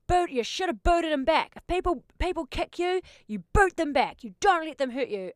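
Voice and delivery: lightly voiced aggressive dad; imitating the dad. The dad telling his daughter to kick back, mock tough. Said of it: "picks her up and goes".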